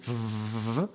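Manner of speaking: long buzzing f noise; sustained f sound. Shown as a demonstration. Teacher sounding out finish's first letter, held f. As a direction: hold out the f. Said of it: "because you are having something".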